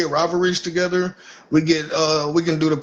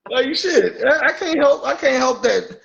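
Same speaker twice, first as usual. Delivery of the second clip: laughing; 7 semitones higher than usual. Real, not acted. Laughing brag after story.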